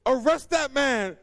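slow, loud, high command; king's shouted order. Acted. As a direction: order an arrest as an acted king.

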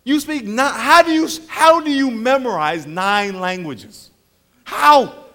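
loud, high, slow astonished how; amazed question. Retold or imitated. Preacher asking the multilingual waiters in loud, high amazement. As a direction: marvel at the linguists. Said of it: "you look at these people and you go".

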